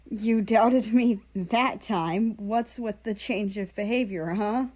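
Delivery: loud, slow, accusing; angry. Acted. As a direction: throw the accusation in anger. America accusing Russia angrily.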